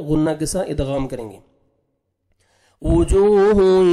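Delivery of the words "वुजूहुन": वुजूहुन drawn out slowly in a recitation chant; Quranic recitation. Shown as a demonstration. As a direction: recite वुजूहुन slowly with tajweed, nasal ghunna. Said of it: "नोज में sound लेके जाएंगे". (वुजूहुन धीरे धीरे तजवीद के साथ पढ़ें)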